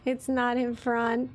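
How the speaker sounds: usual speaking voice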